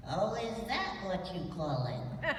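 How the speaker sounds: sassy puppet voice, laughing; cheeky lamb puppet teasing; Lamb Chop puppet voice. Acted.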